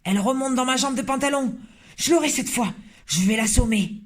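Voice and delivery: high, excited threats; a flustered cook vowing to squash the mouse; agitated cook. Acted. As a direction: get worked up and swat at it. Cook feels mouse in trousers.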